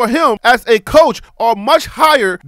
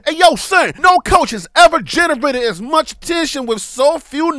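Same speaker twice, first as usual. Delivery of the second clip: put-on Brooklyn accent; 3 semitones higher than usual. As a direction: read it like a Brooklyn dude.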